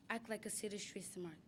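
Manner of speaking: ordinary talk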